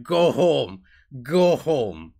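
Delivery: loud, punchy yell as an angry crowd; hammed-up shouted command; emphatic mock yell. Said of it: "just me emphasizing. ... the way that I yell".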